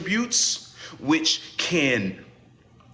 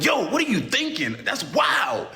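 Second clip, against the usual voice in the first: higher, disbelieving yo; incredulous reaction; 11 semitones higher, slower than usual. Retold or imitated. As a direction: play the stunned friend reacting. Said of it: "I'm like".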